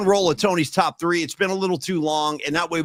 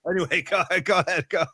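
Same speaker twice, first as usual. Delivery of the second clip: usual speaking voice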